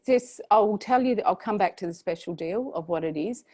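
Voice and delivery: ordinary talk, nothing marked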